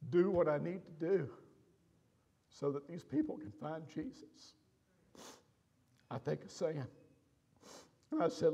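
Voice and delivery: really choked up, halting between phrases. Real, not acted. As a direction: preach through a lump in the throat. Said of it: "I got a little choked up".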